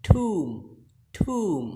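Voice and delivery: tomb as TOOM; long "oo", silent b in tomb; correct pronunciation. Shown as a demonstration. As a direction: say tomb to rhyme with room. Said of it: "Next word is".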